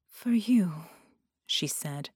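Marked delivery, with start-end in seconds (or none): 0.1-0.9 entranced whisper. Acted.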